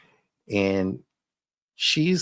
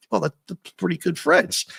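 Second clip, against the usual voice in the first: goofy sidekick voice; 6 semitones higher than usual. Retold or imitated. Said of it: "and he's like".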